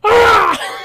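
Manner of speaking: high, loud anguished yell; fed-up yell. Retold or imitated. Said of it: "holds his ears and just goes".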